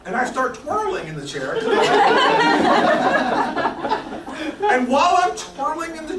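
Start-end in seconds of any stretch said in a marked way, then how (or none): 1.6-4.7 high breathy burst of laughter; big laugh. Real, not acted. Guest cracking up over twirling in the chair. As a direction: let the laugh take over completely.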